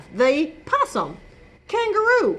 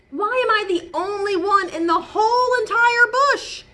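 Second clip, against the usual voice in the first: indignant; 9 semitones higher than usual. Acted. Warren protests indignantly.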